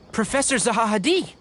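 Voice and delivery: put-on light up at seeing an old acquaintance; surprised greeting. Surprised to meet the professor.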